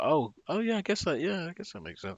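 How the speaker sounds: put-on childhood self. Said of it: "And when I was like".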